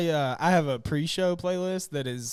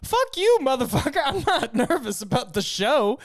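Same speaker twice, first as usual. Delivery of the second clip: high, loud mock snap; mock angry outburst; 9 semitones higher, 3 dB louder than usual. Brandy snapping at the host before the show, loud and high. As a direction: fire it back like an offended friend.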